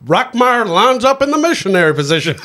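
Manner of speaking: high, laughing baseball announcer impression; old-timey announcer voice. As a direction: call it like a play-by-play man. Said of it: "he talks in that baseball, uh, old-timey announcer voice".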